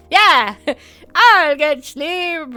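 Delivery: half-hearted reassurance to the guards; mock promise. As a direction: promise it with a sly stretch. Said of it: "And I'm like".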